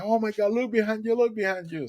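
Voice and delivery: high, urgent alarmed shout; startled cry. Retold or imitated. Fisherman alarmed, telling the rescued man to look back. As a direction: shout it like you can't believe your eyes. Fisherman sees dolphin jumping.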